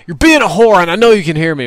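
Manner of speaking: loud mock yell in game chat; half-hammed shout at the enemy; [name] screaming. Teammate yelling at the shooter in game chat, loud mock yell. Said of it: "he just screams at him".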